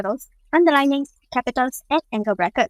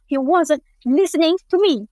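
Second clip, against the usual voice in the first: "He wasn't listening to me." said raised and a bit higher; loud voice; 4 semitones higher, 3 dB louder than usual. Shown as a demonstration. Student demoing loud volume, raised voice. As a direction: say it loudly, with annoyance. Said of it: "symbol for loud volume is also capital".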